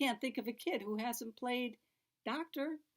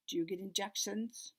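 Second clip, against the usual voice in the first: plain reading voice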